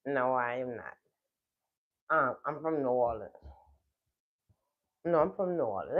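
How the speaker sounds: ordinary delivery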